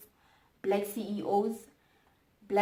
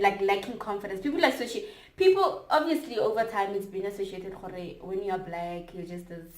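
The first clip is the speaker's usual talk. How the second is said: usual speaking voice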